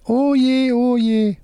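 very high, wailing puppet; left-hand puppet. A narrator's reading. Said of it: "Wolfram mit der linken Hand".

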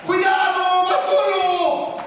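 high, loud, drawn-out yell; shouted warning. Acted. Coach warning his team of a runaway attacker, shouting. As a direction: yell the alarm down the pitch.

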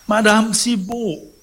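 high, loud, drawn-out call. Acted. Weak patient calls the concierge back, high drawn-out call. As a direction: call her name anxiously.

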